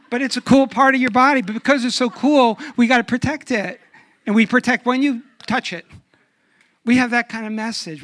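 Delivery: rising pulpit fervor; preaching up. Real, not acted. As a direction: build it with full force. Preaching builds.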